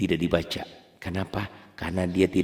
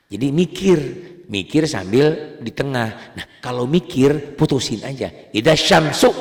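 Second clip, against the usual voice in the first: emphatic teaching; 6 semitones higher, 7 dB louder than usual. Shown as a demonstration. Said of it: "teacher stresses advice".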